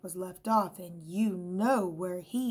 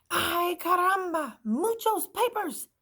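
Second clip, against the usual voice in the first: much higher, loud Spanish dismay; hammed-up Spanish shock; Spanish-accented exclamation; 11 semitones higher, 7 dB louder than usual. A narrator's reading. Chinchetta exclaims.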